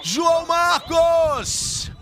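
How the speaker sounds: long drawn-out goal yell; goal shout. Real, not acted. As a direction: scream the scorer's name. Goal call for Goiás. (grito de gol estendido)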